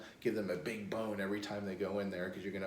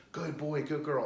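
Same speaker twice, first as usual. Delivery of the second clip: higher, upbeat praise; mild excited praise; 6 semitones higher, 4 dB louder than usual. Shown as a demonstration. Trainer rewarding dog that stopped whining, mildly excited. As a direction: praise the dog with a light lift. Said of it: "act excited".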